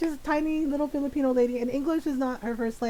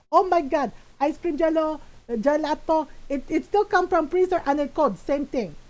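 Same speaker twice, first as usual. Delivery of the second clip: put-on exasperated Filipino-accented mom, pitched up a touch; mom's Filipino accent; 3 semitones higher than usual. Mom insisting it's the same thing, in her Filipino accent. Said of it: "in my mom's voice".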